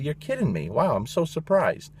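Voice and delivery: mock surprise, pitched high; very sarcastic. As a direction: feign amazement with heavy sarcasm. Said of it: "Very sarcastically".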